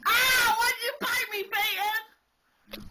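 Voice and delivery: high, loud yelp of pain; startled yelp. Real, not acted. Bitten by the child.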